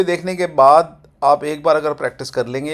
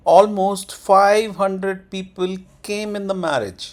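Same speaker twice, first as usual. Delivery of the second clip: slow, higher model sentence, "five" prominent; sentence tone demo; 5 semitones higher, 3 dB louder, much slower than usual. Shown as a demonstration. Teacher modelling the tone, slow raised demo. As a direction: punch "five hundred", fall on "marriage". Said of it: "tone भी इसकी यही रहती है".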